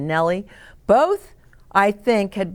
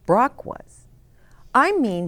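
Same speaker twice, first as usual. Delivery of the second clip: usual speaking voice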